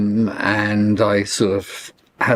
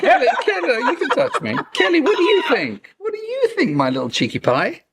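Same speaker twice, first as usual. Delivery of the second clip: dog talk; faster than usual. Real, not acted. Talking to his dog in bed.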